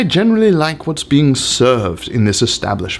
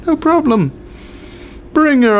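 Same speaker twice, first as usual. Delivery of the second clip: breezy chef reply; 10 semitones higher than usual. Acted. Chef answers a diner's complaint.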